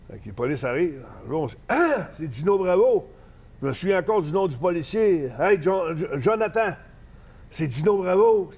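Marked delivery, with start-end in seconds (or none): 1.7-3.1 retelling a cop geeking out at a celebrity; excited cop. Retold or imitated. Cops recognize the wrestler star. 5.4-8.5 retelling a cop geeking out at a celebrity; excited cop. Retold or imitated. Cop calls partner over, starstruck.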